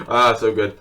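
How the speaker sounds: normal talking voice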